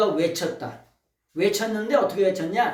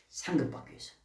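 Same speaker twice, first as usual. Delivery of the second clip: whisper; 4 semitones lower, 10 dB quieter, faster than usual. Real, not acted. Answers own question softly.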